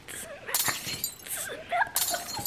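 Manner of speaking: high squeal. Real, not acted. Playful squeal on catchphrase.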